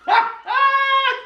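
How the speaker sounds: high-pitched burst of laughter. Real, not acted. Cracking up at his own prison story, high laughter.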